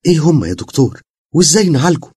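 high, loud urgent plea from a tearful mother; anxious, eager pleading. A narrator's reading. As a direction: beg the doctor for an answer. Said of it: "وقالت بلهفة". (لهفة وقلق)

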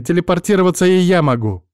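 loud, annoyed boast; irritated shout. Acted. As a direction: snap it back in anger. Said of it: "показывала своё раздражение". (раздражённый крик)

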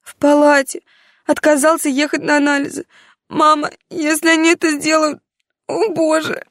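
high, frantic and near tears; a scared girl begging her mother for help; panicked daughter voice. Acted. Daughter sobbing on the phone.

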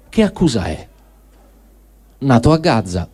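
ordinary talk, nothing marked

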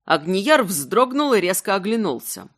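ordinary delivery, nothing marked

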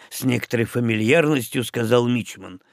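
ordinary delivery

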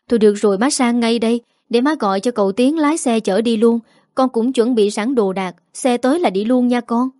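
ordinary delivery, nothing marked

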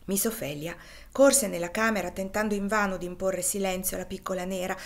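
ordinary delivery, nothing marked

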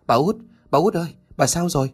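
fast, high, panicked calling; frantic calling. A narrator's reading. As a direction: try to wake her in a panic. Calling to the unconscious woman. (gọi gấp gáp, hoảng hốt)

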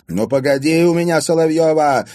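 high, loud furious threat; yelling. A narrator's reading. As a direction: holler after a friend who locked you in. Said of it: "вопила". (вопя от злости)

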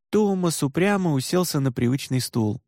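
usual speaking voice

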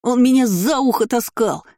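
high-pitched tattling whine; milk it like a whimpering child; sobbing for pity. A narrator's reading. Boy tells on the man, sobbing for pity. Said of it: "всхлипывал Лайер, давя на жалость".

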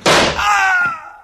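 sudden high, loud cry of pain; pained scream. Acted. Villain crying out as he is shot, sudden loud scream. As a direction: scream as the bullet hits.

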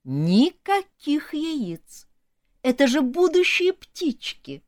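high, very slow preachy elf voice; pontificating elf. A narrator's reading. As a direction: lecture it like a smug elf. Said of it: "разглагольствовал эльф".

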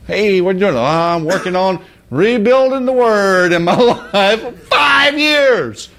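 high, laughing mock voice, ending in a shout on five years; mocking the man. Preacher mocking the man stuck for five years, high laughing shout. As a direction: lampoon the man's excuse, rising to a high yell. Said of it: "I can see him now".

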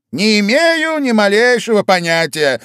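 high, loud, carefree Hatter; the Hatter tossing off his answer; Hatter's loud reply. A narrator's reading.

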